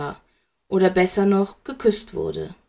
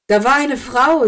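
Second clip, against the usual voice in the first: higher, louder taunting voice; mocking; 5 semitones higher, 6 dB louder than usual. A narrator's reading. Lilly taunts him in the nightmare, mockingly. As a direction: throw it out as a loud jeer. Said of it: "sagte sie spöttisch".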